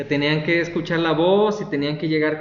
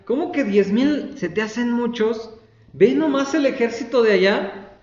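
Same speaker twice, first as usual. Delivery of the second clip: higher-pitched incredulous retort; mock incredulity; 6 semitones higher than usual. Preacher voices God answering Gedeón's doubt, mock incredulous. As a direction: act out a disbelieving comeback.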